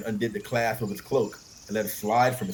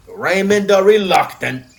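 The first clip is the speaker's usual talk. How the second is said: louder, slow mocking taunt; 8 semitones higher, 11 dB louder, slower than usual. A narrator's reading. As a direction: sneer out the nickname. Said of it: "mocked his cousin Sir Stefan".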